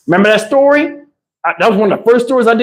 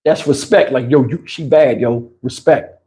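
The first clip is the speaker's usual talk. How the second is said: low cool nod; 9 semitones lower than usual. Retold or imitated. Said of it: "And then you be like".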